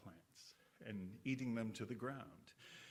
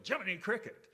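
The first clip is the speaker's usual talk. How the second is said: mock yelp, much higher and louder; imitated yell; 8 semitones higher, 8 dB louder than usual. Preacher imitating a man who hammered his thumb, mock yell. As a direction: cry it out like someone who just hit their thumb. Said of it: "sometimes people would yell".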